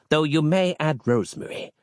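grand, archaic priestly voice; a preacher offering a remedy; pompous holy man. A narrator's reading. Harland speaks.

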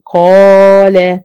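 قال said with the long alif stretched slow and loud; long madd on alif. Shown as a demonstration. Teacher demonstrating the alif madd to her students, slow and stretched. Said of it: "ألف ساكنة ما قبلها مفتوح مثل".

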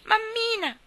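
put-on very high, loud child's scream; shrieking. Frightened little boy running home crying for his mother, shrieking. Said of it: "cominciò a strillare".

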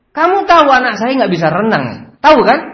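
high, loud accusing questions; acted father's tirade; angry interrogation. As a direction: corner the babysitter furiously. Father scolds the babysitter.

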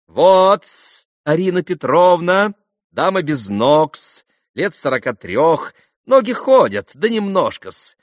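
high, loud ceremonious introduction; buffoonish captain. A narrator's reading. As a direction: present everyone with clownish formality.

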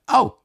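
sharp high loud yelp; pained cry. Acted. Bitten custodian crying out in pain. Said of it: "ruft er".

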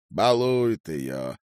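slow, drawn-out sigh of a remark. A narrator's reading. Father complaining about grandmother spoiling his girl, sighing. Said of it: "вздохнул он".

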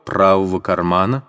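usual speaking voice

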